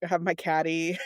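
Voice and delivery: usual speaking voice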